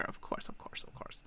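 under-the-breath whisper; whispered aside. Real, not acted. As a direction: whisper it to herself. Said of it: "whispered aside".

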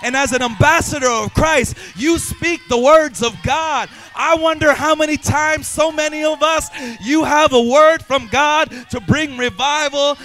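loud, sustained preaching at the top of his voice; full-force preaching. Acted. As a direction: drive it home to the congregation, loud.

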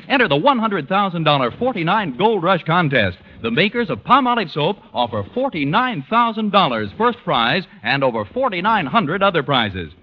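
ordinary talk, nothing marked

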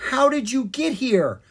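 high, loud, startled demand; shocked cry. A narrator's reading. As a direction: cry out in disbelief. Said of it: "the fairy cried".

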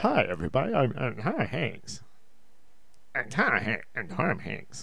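faltering, half-hearted Hanks intro; fumbling a Tom Hanks voice; Tom Hanks attempt. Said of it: "How does the Tom Hanks go?".

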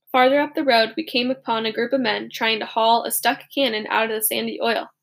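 usual speaking voice

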